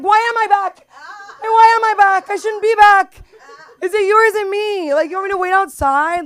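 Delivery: high, loud laughing wail; mock hysterical yell. Answering the kiosk lady, a high, loud, laughing mock wail. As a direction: wail it, laughing near tears. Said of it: "I'm like".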